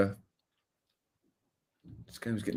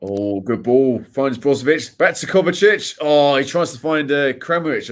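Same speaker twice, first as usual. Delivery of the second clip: louder, higher play-by-play as the move builds; excited commentary; 3 semitones higher, 8 dB louder, slower than usual. Acted. Calling a Croatia passing move live, excited and loud. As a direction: call each pass with mounting excitement.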